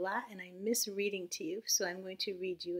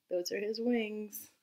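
ordinary delivery

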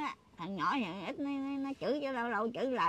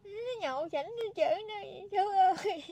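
tearful lament; 10 semitones higher than usual. Real, not acted. Grandma recounts sons' abuse.

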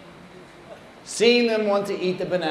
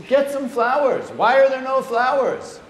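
high, louder imitated demand; agitated; 7 semitones higher, 4 dB louder than usual. Guru finding no flowers for the ceremony, agitated demand. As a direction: push the pitch up in exasperation. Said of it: "he's getting a little agitated".

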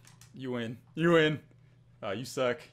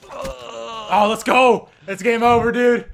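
loud, raised victory yell; excited shout; 6 semitones higher, 13 dB louder, slower than usual. Real, not acted. Player celebrating a winning play, excited shout. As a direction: holler it in triumph.

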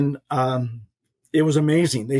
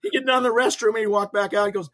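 normal talking voice

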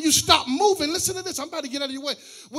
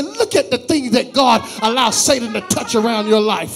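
preaching at full force; 13 dB louder than usual. Acted. Pastor preaching loudly about Job's faith and Satan's limits. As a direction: preach it to the whole church at full volume.

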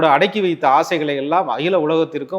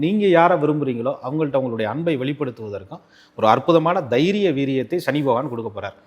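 ordinary talk, nothing marked

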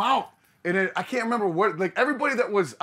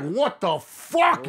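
slow, drawn-out louder what the fuck; stunned; 5 semitones higher, 5 dB louder, much slower than usual. Retold or imitated. Waking on the bus floor, drawn-out stunned cry. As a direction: stretch it out in disbelief. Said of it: "I'm just like".

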